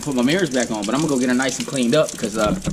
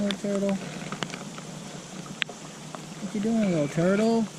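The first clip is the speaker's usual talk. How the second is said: much higher, cooing voice; baby talk; 8 semitones higher, 4 dB quieter than usual. Acted. Vlogger cooing at a turtle in baby talk. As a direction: coo at the little animal.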